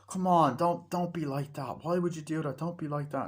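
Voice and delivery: insistent mock appeal; acted pleading. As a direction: plead with someone who is walking off. Pleading in retold quarrel.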